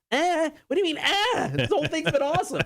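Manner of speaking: high-pitched incredulous squawk; mock indignation. Husband answering his wife's lukewarm verdict on the show, mock indignant. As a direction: protest in a comically high voice.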